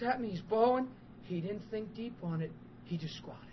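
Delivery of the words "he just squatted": normal talking voice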